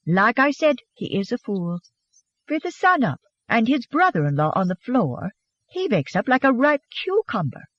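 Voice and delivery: mock her husband in a Swedish accent. A narrator's reading. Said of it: "her Swedish accent beautiful by this time".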